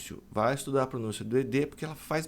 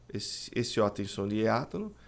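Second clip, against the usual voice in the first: ordinary talk, nothing marked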